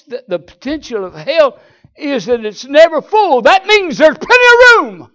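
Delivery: loud, high preacher's cry; preaching at full force. Acted. Preacher warning congregation hell is never full, shouting. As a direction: lift it to a shout from the pulpit.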